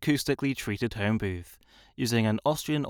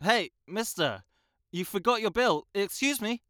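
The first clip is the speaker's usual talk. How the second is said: calling out to someone, higher; raised voice to catch a stranger; level one projection; 8 semitones higher than usual. Shown as a demonstration. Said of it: "a level one projection".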